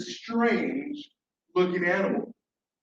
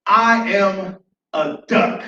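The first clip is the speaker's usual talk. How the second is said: loud, slow, proud announcement; big confident proclamation; newfound confidence; 6 dB louder, much slower than usual. Acted. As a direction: declare it boldly, spacing out each word. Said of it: "the newfound confidence in his i".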